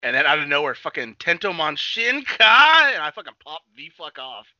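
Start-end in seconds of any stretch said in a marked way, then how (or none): 1.2-2.9 loud, high, drawn-out battle cry; hyped mock anime shout, stretched slowly; anime evolution call. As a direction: belt out the transformation announcement.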